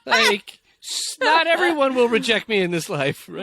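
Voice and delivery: high-pitched, hammed-up relief; mock elation. Acting someone no longer fearing rejection, mock elation. Same acted first-love realization continues.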